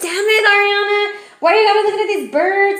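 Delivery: high, mocking whiny complaint; mock exasperated friend. A friend mocking the reader for bird watching, in a high whiny voice. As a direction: squeal it like an annoyed friend teasing her. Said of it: "You're gonna be like".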